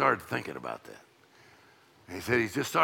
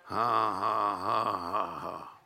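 slow, deliberate ha-ha, trailing away; imitated laughing; 5 semitones lower, much slower than usual. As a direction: imitate a patient's laugh, low and measured. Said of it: "So I just started laughing".

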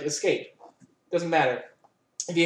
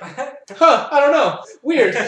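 a light, higher, louder clueless "huh, weird"; puzzled shrug; 7 semitones higher, 5 dB louder, much slower than usual. Retold or imitated. Game character shrugging off the missing body, mock clueless. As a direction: play the shrugging character who doesn't care.

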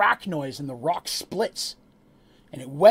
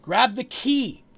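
loud, high, drawn-out yell; 7 semitones higher, 7 dB louder, much slower than usual. Acted. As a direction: shout it out urgently, slow and high. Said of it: "Red yells".

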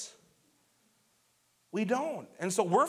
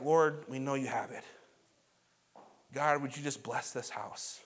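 gentle prayer; 5 semitones lower, 5 dB quieter than usual. Retold or imitated. Family's bedtime prayer.

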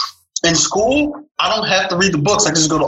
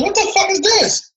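much higher, disbelieving cry; shocked. Retold or imitated. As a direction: recoil at the bronze door knocker. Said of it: "And I'm like".

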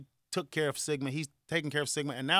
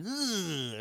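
drawn-out shuddering ugh, higher; disgusted ugh; 4 semitones higher, 5 dB quieter than usual. Retold or imitated. Recoiling from an approaching kitty, acted disgusted ugh. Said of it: "I'm like".

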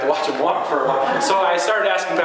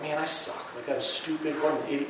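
quietly beating yourself up under your breath; hushed self-criticism after a mistake; muttered self-talk; 9 dB quieter than usual. Retold or imitated. Imitated harsh inner self-talk.

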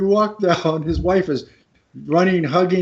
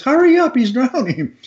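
urgent plea; 7 semitones higher than usual. Retold or imitated. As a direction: hurry him along as the panicked wife. Retold wife urging slow lifeguard.

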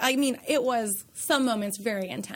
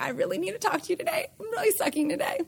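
higher, fast and soft, breaking into tears; crying; 6 semitones higher, 5 dB quieter, faster than usual. Real, not acted. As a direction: push the words out through real tears. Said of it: "I'm gonna try not to get emotional".

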